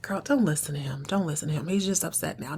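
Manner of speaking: low, half-whispered dismissal; hushed reassurance. Retold or imitated. Angela tells Francesca to ignore him, hushed. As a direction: drop your voice to wave it away confidentially. Confiding, brushing off the nephew.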